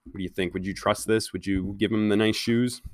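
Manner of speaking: soft, hushed questions to the audience; quiet aside to listeners. Real, not acted. Reader asks listening kids whether they'd trust him, hushed. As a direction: lean in and ask the listeners quietly.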